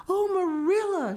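breathless, high whispered gasp; breathless exclaim. A narrator's reading. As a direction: burst in out of breath. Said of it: "she exclaimed breathlessly".

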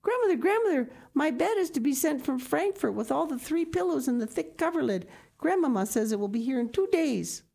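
bursting with happy news; excited child. Acted. Heidi rushing to tell grandmother the news, excited. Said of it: "could not get out her words quickly enough".

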